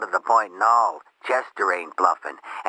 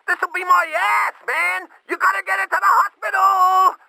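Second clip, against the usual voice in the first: frantic, much higher, loud desperate plea; panicked shouting; 3 dB louder than usual. Acted. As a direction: scream for help, voice cracking with panic. Panicked call about overdose.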